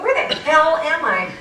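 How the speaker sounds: high, loud exasperated outburst; freaking out. Retold or imitated. Lost driver fuming alone in the car, freaking out. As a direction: blurt it out, lost and frazzled. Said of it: "freaking out".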